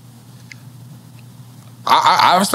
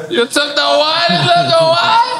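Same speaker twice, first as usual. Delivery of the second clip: put-on Jamaican accent. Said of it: "Jamaican accent keep coming out".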